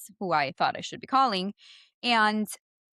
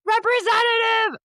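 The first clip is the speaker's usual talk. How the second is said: frustrated shout; 7 dB louder than usual. Retold or imitated. Shouting representative at the phone menu. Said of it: "I know I have an accent".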